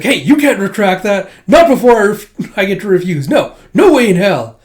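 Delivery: put-on high, loud, huffy boy objecting; indignant protest. Boy refusing to be dumped first, loud and high. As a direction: protest hotly, voice climbing. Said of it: "He's like".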